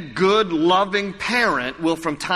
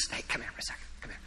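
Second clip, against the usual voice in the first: whisper; 18 dB quieter, much faster than usual. Real, not acted. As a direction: whisper it so the mom won't hear. Sneaking to the child.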